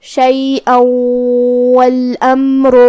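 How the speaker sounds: شیئا والامر with the tanween merged into the واو plus a nasal hum; slow tajweed demo of a nasal merge; idgham with ghunna. Shown as a demonstration. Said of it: "تو ہم یہ حکم کو ایسے ادا کریں گے".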